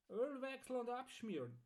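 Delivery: high-pitched, sing-out order; Archibald the customer. Acted.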